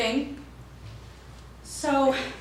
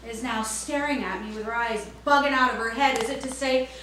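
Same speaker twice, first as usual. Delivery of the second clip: ordinary talk, nothing marked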